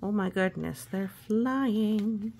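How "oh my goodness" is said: plain reading voice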